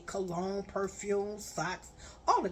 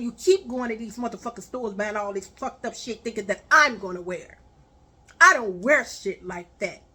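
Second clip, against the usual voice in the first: louder and faster, scolding; angry rant; 8 dB louder than usual. Retold or imitated. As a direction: tear into him, raising your voice. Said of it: "I said".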